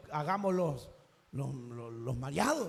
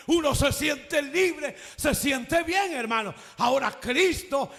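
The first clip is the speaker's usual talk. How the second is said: full-force preaching; 5 semitones higher, 8 dB louder than usual. Acted. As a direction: preach it, building to a shout. Preacher builds to full-force climax.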